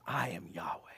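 whispered divine name. Acted. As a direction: breathe the name as a hushed reveal. God's line whispered by preacher.

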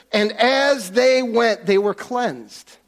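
slow emphasis on the verse; slow, weighty reading. Shown as a demonstration. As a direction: read slowly, letting it land. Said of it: "Do you see this?".

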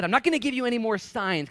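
high, loud emphatic refusal; voicing Jesus. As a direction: shut them down firmly. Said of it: "and he said".

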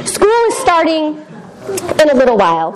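slow, deliberate self-correction. Shown as a demonstration. Speaker correcting herself to the youth group, slowly. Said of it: "Oh, I didn't say that".

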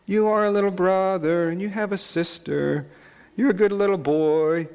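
put-on high singsong child voice; child's lullaby voice. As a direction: croon like a small sister. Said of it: "she sang in a very soft voice".

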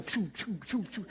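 short puffed lip noises; imitated quivering lip. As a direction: puff out choppy little sobbing-lip sounds. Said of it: "that bottom lip would go".

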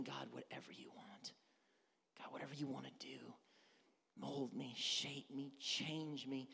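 hushed, low prayer, almost whispered at first; quietly offering oneself up to God; surrendered prayer. Acted. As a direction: pray it softly and humbly.

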